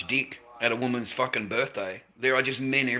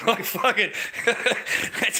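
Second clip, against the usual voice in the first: breaking into laughter mid-phrase; laughing; 9 semitones higher than usual. Real, not acted. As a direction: laugh through the words. Laughs at own joke.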